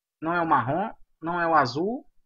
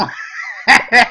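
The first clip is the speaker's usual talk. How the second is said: loud laugh; 5 semitones higher, 12 dB louder than usual. Real, not acted. Player killed right in front, loud laughing fit.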